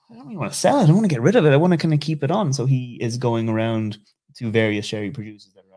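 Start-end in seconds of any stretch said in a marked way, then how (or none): 0.1-2.5 high, loud put-on voice of the grandson; retold loud reply. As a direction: blurt it out high and loud, playing him. Grandson voiced answering buyers.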